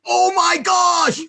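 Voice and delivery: drawn-out, high mock-horrified shout; over-the-top enthusiasm. Shown as a demonstration. Co-host parodying a conspiracy rant, over-the-top enthusiasm. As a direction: wail it slowly at full volume, hamming it up. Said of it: "say this with enthusiasm, emphasis, and energy".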